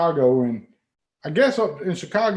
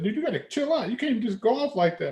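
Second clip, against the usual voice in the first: much higher, soothing mock voice of the local; imitating Chicago guy; 6 semitones higher than usual. Chicago stranger telling him to calm down, mock soothing. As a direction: play the guy calming him down.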